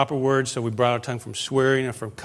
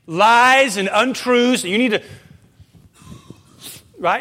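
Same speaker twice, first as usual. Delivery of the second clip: loud, higher fiery sermon delivery; preaching; 7 semitones higher, 8 dB louder than usual. Acted. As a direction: preach it hard to the congregation. Preaching at full force.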